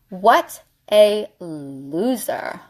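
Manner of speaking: very slow, word by word; slow emphatic repeat. Real, not acted. Dismissing the guy who never called, slow deliberate scorn. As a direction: stretch out each word for emphasis.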